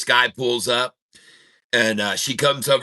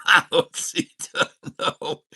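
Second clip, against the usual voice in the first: words squeezed out through laughter; can barely speak for laughing; breathless giggly half-words; 8 semitones higher, slower than usual. Real, not acted. Cracking up at own joke.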